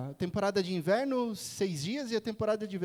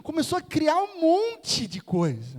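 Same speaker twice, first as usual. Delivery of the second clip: loud, high, stretched exclamation; exasperated outburst, drawn out; exasperated exaggeration; 8 semitones higher, 9 dB louder, slower than usual. Real, not acted. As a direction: blow up the sheer amount with a long emphatic swell. Exclaiming over wife's spiraling worries.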